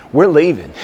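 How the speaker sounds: high, loud, winded insistence; re-enacted gasping protest; acted breathlessness. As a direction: blurt it out between breaths. Re-enacts breathless demand to leave.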